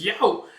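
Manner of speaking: high, loud "yow"; acted little ouch; yelp of pain. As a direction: cry out as if bonked. Elf hurt by headbutt.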